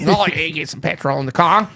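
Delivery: playful Australian accent impression; mock Australian accent. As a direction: put on broad Aussie vowels. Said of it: "pick up an accent".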